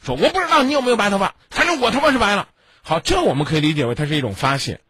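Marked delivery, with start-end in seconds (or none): 0.2-2.5 mock yell. Shown as a demonstration. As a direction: bark the line at her like an angry husband. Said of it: "他也没有吼你".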